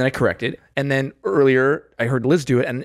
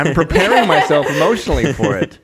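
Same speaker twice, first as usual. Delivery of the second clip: laughing, higher and louder; 5 semitones higher, 7 dB louder than usual. Real, not acted. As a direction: say it through a laugh. Laughing joke after poetic answer.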